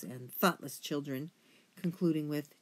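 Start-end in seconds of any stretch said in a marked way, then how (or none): none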